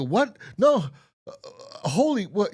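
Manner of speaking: high, loud hammed-up disbelief; mock shock. The killers seeing the dead man sitting there, in hammed-up shock. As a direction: gasp it out as stunned killers. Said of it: "they go".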